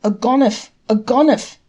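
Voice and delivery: shouting thief, loud and high; hue and cry. A narrator's reading. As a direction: raise the alarm at full voice. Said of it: "he raised a hue and cry".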